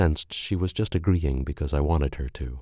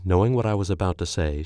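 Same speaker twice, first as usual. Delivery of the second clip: ordinary delivery, nothing marked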